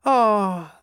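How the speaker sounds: high, falling "ah" of regret; wistful sergeant's "ah"; sad sigh. A narrator's reading. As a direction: sigh it with mild sorrow. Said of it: "the sergeant said sadly".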